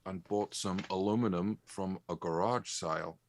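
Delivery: quiet, put-on New Zealand accent; New Zealand accent attempt. Shown as a demonstration. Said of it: "I was New Zealander".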